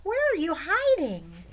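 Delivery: very high, loud calling; children calling out. Acted. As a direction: call across the forest for the turtle.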